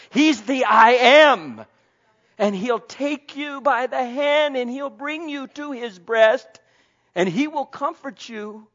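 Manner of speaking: loud, high preaching climb; preaching at full force. Acted. Preacher rousing the congregation, loud and high. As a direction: lift the congregation with fervent preaching.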